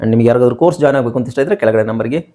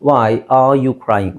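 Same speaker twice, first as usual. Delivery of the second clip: very slow, each word separated; emphatic model question; much slower than usual. Shown as a demonstration. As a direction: give the corrected question slowly and deliberately. Teacher models the corrected question. (ನಿಧಾನವಾಗಿ, ಪದಪದವಾಗಿ ತಿದ್ದಿ ಹೇಳುವುದು)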